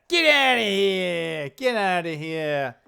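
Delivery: loud, drawn-out mock New Yorker; stretched-out, exaggerated New York accent. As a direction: send them packing in a hammed-up New York accent. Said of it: "do my New York accent".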